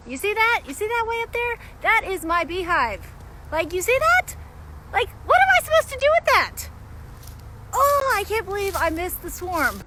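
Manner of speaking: high, loud cries of disbelief; real shock at the lost swarm; excited disbelief. Real, not acted. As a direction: point up at the tree, can't believe it. Spotting the swarmed hive up high.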